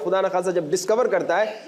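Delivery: ordinary delivery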